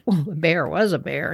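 plain reading voice

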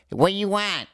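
high, put-on Boston stripper accent; Boston accent, high and pushy. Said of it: "a Boston stripper".

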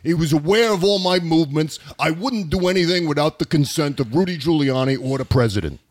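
a hint of Russian mixed with Goodfellas New York; lightly voiced Lev Parnas, Russian-New York accent; mild Russian Mafia accent. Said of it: "there's my accent for you".

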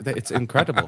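normal talking voice

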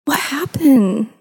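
put-on high, slow, worried toddler voice; two-year-old niece. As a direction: ask it like a concerned little girl. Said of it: "and she was like".

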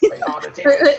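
laughing it out, high and loud; cracking up while he says it. Real, not acted. Guest laughing at the host's teasing, high and loud. Host teases about his tastes.

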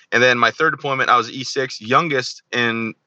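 ordinary talk

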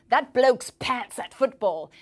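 put-on slow, loud bloke's insult in British accent. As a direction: sneer it like a football fan, British accent. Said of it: "Pants is also an insult, as in".

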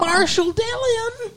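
high, slow drawn-out cowboy call; Marshall Dillon. Retold or imitated. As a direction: stretch it like an old western. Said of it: "That's from Gunsmoke".

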